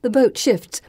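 usual speaking voice

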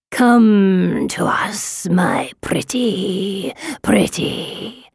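very slow, whispery monster moan; rasping moan. A narrator's reading. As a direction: draw it out, breath fading into a ghostly whisper. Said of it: "the rasping moan escapes".

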